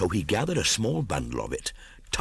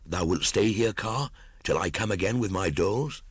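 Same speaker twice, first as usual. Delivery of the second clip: put-on higher, keen boyish voice; eager young Mowgli; 7 semitones higher than usual. A narrator's reading. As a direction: sound bold and ready for the chase. Mowgli answers Kaa eagerly.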